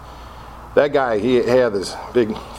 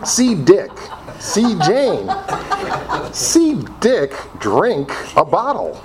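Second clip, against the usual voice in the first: lightly voiced, higher storybook reading; children's primer reading; 7 semitones higher, slower than usual. Speaker imagines an AA coloring book, primer reading. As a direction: read it like a first-grade primer.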